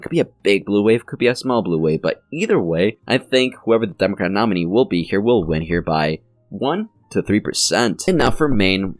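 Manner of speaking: usual speaking voice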